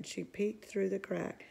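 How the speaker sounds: usual speaking voice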